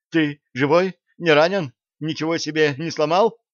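high, loud anxious fussing; frantic worry. A narrator's reading. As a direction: fret over him in a panic. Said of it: "причитала девчонка".

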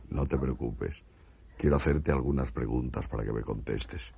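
calm the frightened man as an alien. Acted. Martian speaking to a frightened stutterer in a low alien voice.

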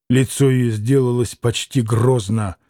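usual speaking voice

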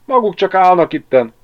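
high, loud, agitated reproach; indignant reproach. A narrator's reading. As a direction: scold them for idling. Ágnes reproaches the idle men. (felháborodva, hangosan)

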